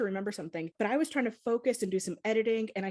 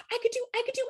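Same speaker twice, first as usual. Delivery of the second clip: much higher, eager excited squeak; excited coworker; much faster than usual. Retold or imitated. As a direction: bubble over with excitement. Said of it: "getting excited ... she was like".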